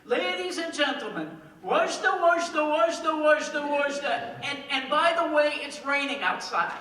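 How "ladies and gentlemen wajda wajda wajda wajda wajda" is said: garbled announcement. Retold or imitated. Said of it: "all it sounds like is".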